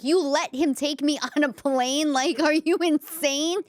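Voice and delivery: are you kidding me, to mom; incredulous at mother. Retold or imitated. Grown daughter to her mother, looking back, incredulous. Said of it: "I'm like".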